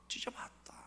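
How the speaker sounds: breathy whispered admission. Shown as a demonstration. Preacher whispering the congregation's confession. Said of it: "answering himself softly".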